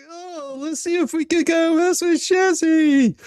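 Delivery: high, loud, sing-song mock of the trolls. Streamer mocking trolls who came to mess with him, high and loud. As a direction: sneer out their scheme in a silly high voice. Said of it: "And they're like".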